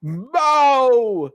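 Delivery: high, slow fight-night announcing; dwarf announcer introducing Bao Lee; ring announcer intro. Acted. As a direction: drag out the fighter's name for the crowd.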